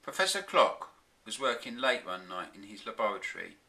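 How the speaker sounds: usual speaking voice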